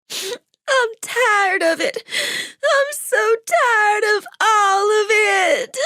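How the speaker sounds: high, tearful and worn out; weeping. A narrator's reading. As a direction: cry it out, exhausted. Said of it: "began to weep".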